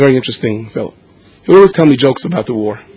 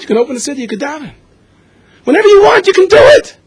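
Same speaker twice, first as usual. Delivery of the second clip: much higher and louder, pleading cry; desperate praise; 5 dB louder than usual. Retold or imitated. Father crying out to Rabbeinu Shalom, high desperate pleading.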